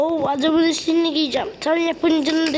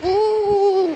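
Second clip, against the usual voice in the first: usual speaking voice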